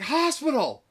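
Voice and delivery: high, loud retold yell; hammed-up high-pitched cry; mock urgent shout. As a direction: shout it like an acted emergency.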